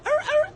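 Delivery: high, yappy mock bark, like a little dog; imitated dog bark. Acts like a dog, barks.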